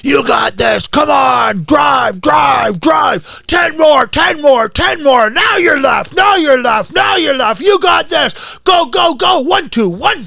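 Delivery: high, full-force shouted encouragement; relentless high-pitched workout cheering; cheerleader coach. Shown as a demonstration. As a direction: scream rhythmic hype to drive an athlete on. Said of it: "I started out as a cheerleader".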